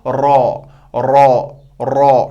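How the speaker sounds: रॉ said slowly with rounded lips, a thick r; heavy raa. Shown as a demonstration. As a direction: round the lips, drag out रॉ heavily. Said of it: "मोटा है".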